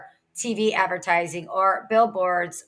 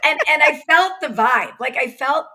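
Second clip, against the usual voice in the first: animated; 6 semitones higher, 7 dB louder than usual. Real, not acted. Excited retelling, raised voice.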